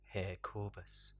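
ordinary delivery

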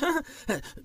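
high squeaky laugh; nervous giggle. Acted. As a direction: giggle guiltily after a weak excuse. Thief's nervous laugh after excuse.

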